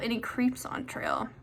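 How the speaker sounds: slow spooky whisper. Acted. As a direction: hush it like a secret.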